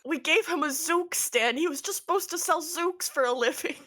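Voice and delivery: high-pitched indignant explaining; mildly hammed-up dismay; bewildered protest. Father protests on son's behalf.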